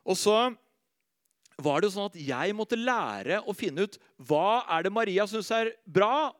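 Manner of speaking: ordinary talk, nothing marked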